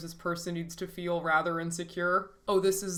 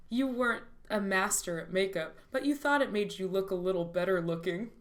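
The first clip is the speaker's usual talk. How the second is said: ordinary talk, nothing marked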